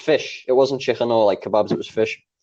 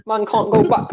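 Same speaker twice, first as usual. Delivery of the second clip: high put-on roadman voice; mock street-baby protest; newborn roadman; 9 semitones higher than usual. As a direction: swear like an indignant baby roadman. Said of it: "comes out the womb talking".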